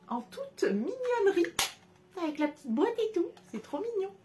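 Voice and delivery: overwhelmed by cuteness, high squeak; whiny cute squeal. Real, not acted. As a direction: whimper with delight. Gushing over Hello Kitty cutlery.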